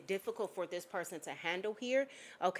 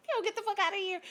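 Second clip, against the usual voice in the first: scoffing dismissal; 13 semitones higher, 5 dB louder, faster than usual. Retold or imitated. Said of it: "it's that type of energy".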